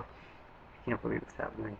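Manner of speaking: soft confiding whisper; hushed aside. Acted. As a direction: lean in and whisper it to the crowd. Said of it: "aside in whisper".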